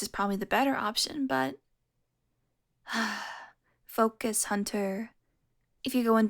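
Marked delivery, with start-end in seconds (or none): 2.9-3.4 short, soft exhale; impatient sigh. Acted. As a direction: let out a quiet sigh. Impatient with dazed hunter.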